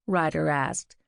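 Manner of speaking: plain reading voice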